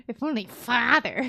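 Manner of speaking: whiny mimicking tone. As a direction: whine it like a sulky kid. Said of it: "I love the whiny voice he adapted".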